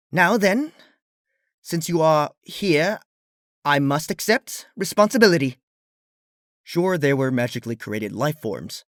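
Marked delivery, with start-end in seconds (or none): 0.1-5.6 lightly voiced regal princess; formal princess. A narrator's reading. Luna addresses her creations, mildly regal.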